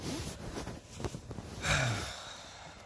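long, drawn-out breathy sigh. Real, not acted. Vlogger stuck explaining himself to viewers, long sigh. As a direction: let out a long heavy sigh.

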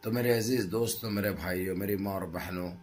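ordinary talk, nothing marked

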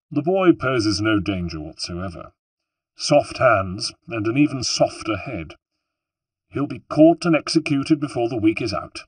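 proud politician mocking a runaway boy; smug official. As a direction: scoff like a self-satisfied statesman. Alberti scoffs at Ezio to nobles.

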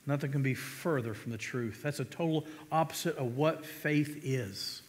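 usual speaking voice

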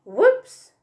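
high, loud exclamation; startled whoops. A narrator's reading. Little Monkey drops onto sleeping Big Monkey, startled. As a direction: cry out at a sudden tumble.

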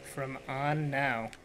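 slow, drawn-out teasing repeat; mocking echo. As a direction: echo the slip slowly, stretching "on". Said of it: "you English good too".

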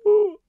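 high-pitched mocking "ooh"; hammed-up whimper of pity; mock wail. Mocking a tearful sob story.

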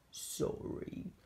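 slow, soft creature voice; drawn-out, quiet apology; Yeti voice. Acted. Yeti turns down George's gifts, slow soft creature voice.

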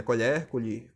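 "Colher, colher": first open "co-LHÉR", then closed "co-LHÊR". Shown as a demonstration. Teacher alternating homographs, "colher" open E then closed E. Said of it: "Perceba a diferença".

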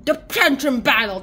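big, loud fight announcement; mock announcer. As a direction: announce it like an epic showdown.

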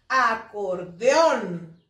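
ACORDEÓN drawn out slowly, with force on ÓN; stress on last syllable. Shown as a demonstration. Teacher showing pupils the stressed syllable, last syllable stressed. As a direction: say "acordeón" syllable by syllable, landing hard on -ón. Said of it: "se le imprime más fuerza".

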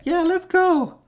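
mock girlish reply; girl's voice. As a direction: pitch way up as the girl agreeing.

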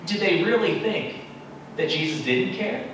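ordinary talk

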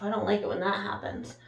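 usual speaking voice

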